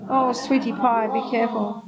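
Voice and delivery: sweet talk to a trapped bird; coaxing a bird. Real, not acted. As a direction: guide the little bird out gently.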